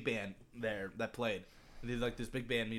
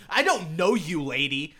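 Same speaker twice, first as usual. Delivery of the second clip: a snapped, louder brush-off; nasty retort; 3 semitones higher, 8 dB louder, slower than usual. Retold or imitated. Old lady in the elevator snapping at the aunt, mock nasty. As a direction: bite back at a stranger, mock nasty. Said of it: "gets really nasty all of a sudden".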